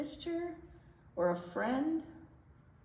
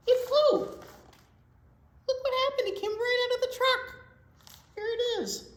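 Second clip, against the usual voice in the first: much higher and louder, quick excited cry; thrilled storytime exclamation; 8 dB louder than usual. Acted. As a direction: burst out like you just saw it fly. Box flies out of truck.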